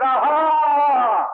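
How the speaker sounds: loud, high jeering aha; mocking triumphant cry; gloating mockery. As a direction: crow over the fallen like Edom. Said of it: "Edom stood by the road and they said".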